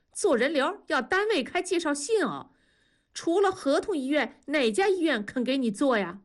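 put-on high, taunting old woman's retort; quick retort. A narrator's reading. Mother-in-law to Wenli, high taunting quick retort. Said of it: "话接得贼快".

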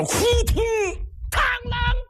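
thud and clang sound effect. Acted. Brother falls, sword clatters.

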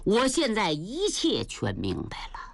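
plain reading voice

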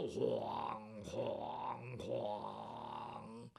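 low, soft, long ringing gong strokes; distant gong imitation. A narrator's reading. Storyteller imitates gongs clearing the road far off, slow and soft. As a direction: stretch each clang out and let it hum away. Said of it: "老远的就听见鸣锣开道".

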